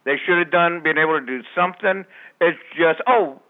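ordinary talk, nothing marked

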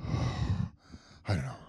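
sighed, very low and slow; weary sigh. Real, not acted. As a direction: let it out on a tired sigh, trailing off. Sighing after the rant.

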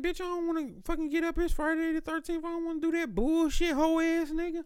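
high, fast squeaky griping; whiny inner rant. Retold or imitated. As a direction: rant it up high like a cranky kid. Inner rant about getting up.

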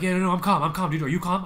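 too loud, insisting he is calm; anxious man loudly claiming he's calm; mock calm. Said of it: "I was not fine".

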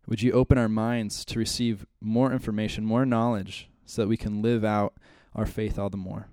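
soft, low, measured public prayer. Real, not acted. Leads the class in prayer.

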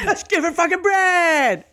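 high, slow yelling rant; screaming comic rant. Pretend.

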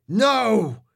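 high, loud shriek. A narrator's reading. Ninja shrieking at his defeat. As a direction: shriek out the loss. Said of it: "shrieked the ninja".

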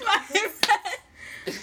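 giggling through the words; can't stop laughing. Real, not acted. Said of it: "I can't stop laughing".

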